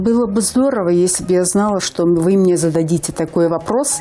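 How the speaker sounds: ordinary talk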